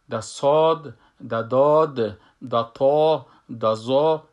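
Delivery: ساد، داد، تا، زا said heavy with long back vowels; emphatic letters. Shown as a demonstration. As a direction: hit each letter name loud and full, as in ساد. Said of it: "ای هر چهار از این مفخم هستن". (ساد، داد، تا، زا پر و مفخم)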